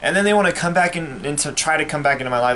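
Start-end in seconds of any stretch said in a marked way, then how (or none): none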